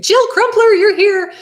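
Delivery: high, loud delighted call-out; light up and greet her by name; excited greeting. Real, not acted. Speaker spots her coworker Jill in the audience, delighted call-out.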